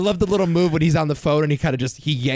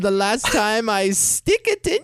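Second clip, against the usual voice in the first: put-on slow, higher-pitched hammy Russian accent; over-the-top Russian accent; 5 semitones higher than usual. Co-host taunting as the poker villain, slow overblown Russian accent. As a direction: stretch it into a bad movie Russian. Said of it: "does this very over-the-top Russian accent".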